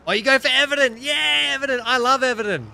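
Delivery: high, loud burst of delight; excited cheer. Real, not acted. Streamer finding a fellow Everton fan, excited cheer. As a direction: cheer it out at full voice.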